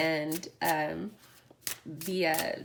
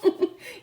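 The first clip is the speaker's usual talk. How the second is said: high, short giggle; a real burst of high giggling; laugh; 12 semitones higher, 6 dB louder than usual. Real, not acted. Delighted reaction to viewers.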